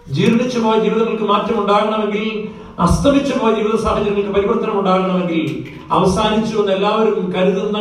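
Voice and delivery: high, loud pulpit proclamation; fervent preacher's cry; full-force preaching. Acted. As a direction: thunder the promise to the congregation.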